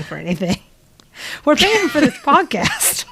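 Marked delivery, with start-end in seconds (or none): 1.4-3.1 high, drawn-out line breaking into laughter; real laughter bubbling into the words; laughing protest. Real, not acted. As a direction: laugh through it, slower and higher. Joking they pay for podcast.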